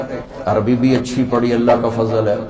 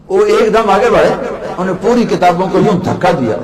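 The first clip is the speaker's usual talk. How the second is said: ordinary talk, nothing marked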